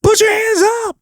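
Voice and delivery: very high rock scream; shouted command. Shown as a demonstration. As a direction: scream it as an order to the crowd. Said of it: "I actually like to give commands".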